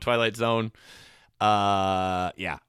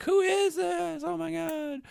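high, slow, frightened gasp of a question; a widow spooked by a call from nowhere; frightened woman; slower than usual. Retold or imitated. Woman on the phone to an unknown caller, high and frightened. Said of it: "And she's like".

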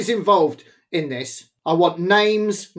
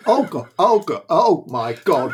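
dismay; 6 semitones lower than usual. Real, not acted. Chilli chip starts burning.

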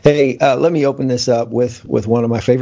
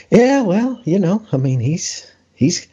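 mock hesitant praise; 9 semitones higher than usual. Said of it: "You're like".

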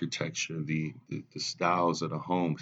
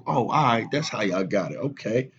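higher, louder, playing along; mock acceptance; 5 semitones higher, 4 dB louder than usual. To his father, going along with the fortune story, mock acceptance. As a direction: fake agreement, sarcastic. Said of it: "I'm like".

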